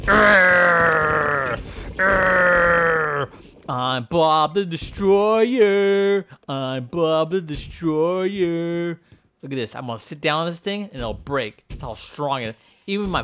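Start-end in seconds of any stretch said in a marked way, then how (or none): 0.1-3.3 long, loud growling roars; mock-monster battle roars; destroyer roar. Roaring as Bob the Destroyer. 3.7-9.0 slow, drawn-out monster proclamation; hammy, stretched-out battle cry; mock destroyer boast.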